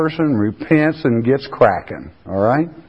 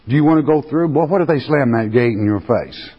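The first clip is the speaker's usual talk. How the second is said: ordinary talk, nothing marked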